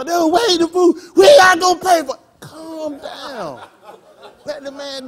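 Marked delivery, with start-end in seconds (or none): 0.1-2.2 high, loud mock shout; excited woman's outburst. 2.4-3.6 high, softened, drawn-out hush; mock soothing. Acted calming reply to shouting.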